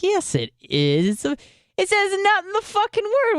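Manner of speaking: high, indignant squeal of protest; mock outrage. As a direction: protest like a wronged kid, pitch jumping high. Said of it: "I'm like".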